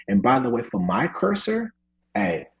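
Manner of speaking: normal talking voice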